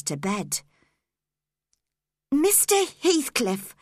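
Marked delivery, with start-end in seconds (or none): 2.3-3.8 higher, pleading call; girl begging her captor, pleading. Acted. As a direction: beg him, voice lifted. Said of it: "begged Cathy".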